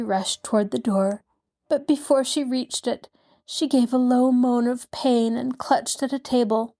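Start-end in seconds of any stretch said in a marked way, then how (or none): none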